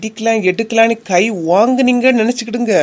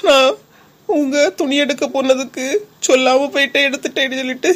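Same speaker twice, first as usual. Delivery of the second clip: fake tearful complaint; 7 semitones higher than usual. Wife fakes tearful complaint to neighbours.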